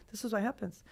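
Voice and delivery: usual speaking voice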